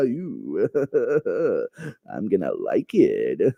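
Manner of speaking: long put-on giggle, then low, slow line; giggle, then drop very low and slow; laughing intruder. Hidden intruder answering the searcher, giggling low creepy-figure voice.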